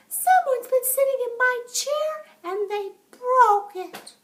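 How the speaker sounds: very high little bear voice, upset; high squeaky wail of a small cub; baby bear. A narrator's reading. Baby bear finds his chair broken, high and upset. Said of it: "the baby bear said".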